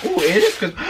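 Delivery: ordinary talk, nothing marked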